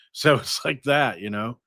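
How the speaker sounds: usual speaking voice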